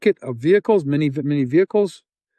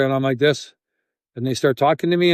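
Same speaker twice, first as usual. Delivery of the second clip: usual speaking voice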